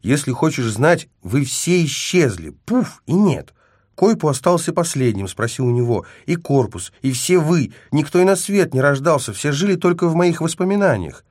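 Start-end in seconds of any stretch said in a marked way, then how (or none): 0.0-5.3 high, loud, indignant retort; heated rebuttal. A narrator's reading. Hero insisting everyone had vanished, indignant. 6.3-11.2 high, loud, urgent argument; heated insistence. A narrator's reading. Hero telling her they all had vanished, insistent. Heated account continues in the quarrel.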